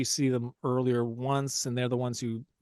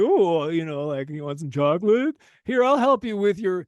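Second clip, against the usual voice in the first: imitating eager nerdy guy; 4 semitones higher, 4 dB louder than usual. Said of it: "And he's like".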